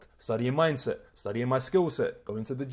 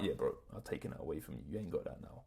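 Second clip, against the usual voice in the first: low taunting aside; 8 semitones lower, 13 dB quieter, faster than usual. Retold or imitated. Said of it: "imagine I'm like".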